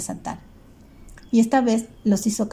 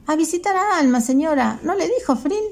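put-on high, innocent boy's voice; a little boy piping up to an adult; young boy; 8 semitones higher than usual. Boy Federico answers the mother.

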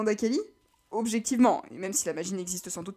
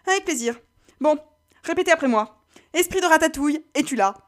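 voix de personnage; 7 semitones higher, 7 dB louder than usual. Acted. Prude mène l'invocation.

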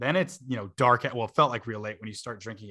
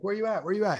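calling out; 8 semitones higher, faster than usual. Retold or imitated. Phoning to find lost roommate.

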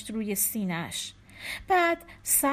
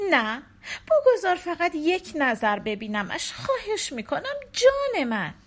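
rising plea, climbing much higher; hint of a desperate young mother; pleading wife; 6 semitones higher, 4 dB louder than usual. A narrator's reading. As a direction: beg him, voice lifting. Wife begs to see baby.

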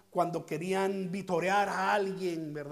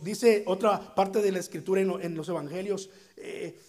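normal talking voice

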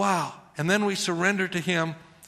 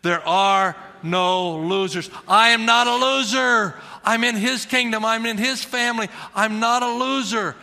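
call and response; 5 semitones higher, 5 dB louder than usual. Shown as a demonstration. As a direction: lead the church in a declaration. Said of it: "Can you say that with me?".

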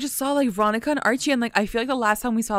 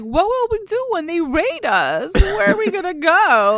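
mock panic; 10 semitones higher, 4 dB louder than usual. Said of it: "And then Josie's like".

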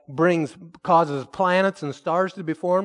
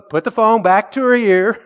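urgent instruction; 6 semitones higher, 9 dB louder than usual. Retold or imitated. Pastor's urgent instruction retold.

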